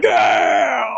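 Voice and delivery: loud, high imitation of a cheering crowd; mock crowd cheer. Comic retells crowd cheering the firefighters, mock roar. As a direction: whoop like a whole audience. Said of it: "everyone was like".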